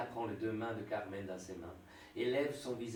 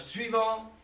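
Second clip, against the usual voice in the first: calling out; 11 semitones higher, 10 dB louder than usual. Acted. Clerk calling next client.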